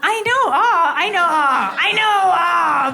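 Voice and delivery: mock-sweet coo at the crowd's aww. Real, not acted. Mock-sweet coo back at the crowd's aww over the shy date.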